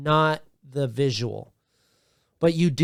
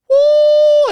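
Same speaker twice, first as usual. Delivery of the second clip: very high, loud joyful whoop; excited whoop; 11 dB louder than usual. Retold or imitated. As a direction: let out a huge excited woo. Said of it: "so excited".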